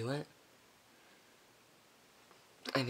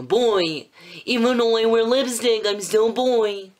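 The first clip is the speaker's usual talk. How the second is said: higher, louder diva insistence; Miranda voice; 9 semitones higher, 8 dB louder than usual. Acted. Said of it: "That is my Miranda impression".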